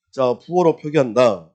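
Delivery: ordinary talk, nothing marked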